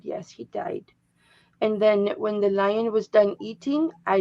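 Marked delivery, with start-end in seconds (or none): 0.0-0.9 whispered, quick and flat, as Jacob; hushed grim answer. Retold or imitated. Quoting a friend's answer about the lion: whispered. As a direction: drop to a bare whisper for the death.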